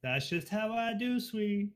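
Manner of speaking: high and slow, sassy and camp; sassy sweetie. Acted. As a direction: purr it with sass. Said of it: "Did you just call me sweetie?".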